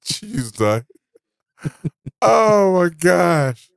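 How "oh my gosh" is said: slow, high oh my gosh; drawn-out, higher amazed oh my gosh; amused disbelief. Real, not acted. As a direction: stretch it out in disbelief.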